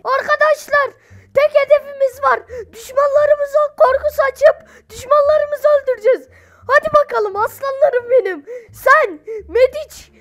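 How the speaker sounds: high, loud rallying speech; hammed-up pre-battle address to soldiers; mock army commander. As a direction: play a commander firing up the troops. Rallies troops as their commander.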